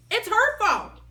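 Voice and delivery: high, loud blaming shout. A narrator's reading. Both girls shouting at the teacher, high and loud. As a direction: shout it at each other. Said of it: "we shouted together".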